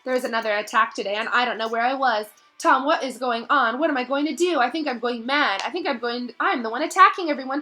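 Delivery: high, loud and rushed panic; a frightened girl losing her grip; desperate diary pleas building to a cry of Tom; frantic Ginny. Acted.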